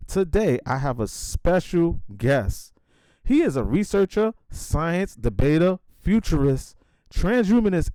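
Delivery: loud, high hype-man podcast intro; announcer intro. Acted. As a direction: pump up the show opening like a radio host.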